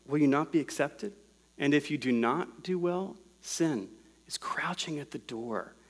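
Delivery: soft, measured warning with pauses; quiet, earnest scripture quote, nearly whispered at moments; hushed pleading. Acted. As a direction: drop your volume as if coaxing someone back from danger. God pleads with Cain, quoted.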